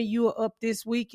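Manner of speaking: usual speaking voice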